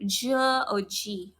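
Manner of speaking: G said as a soft J, "juh" or "jee"; drawn-out soft J for G; wrong G sound. Shown as a demonstration. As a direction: slowly show G as the soft J sound. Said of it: "We don't pronounce G".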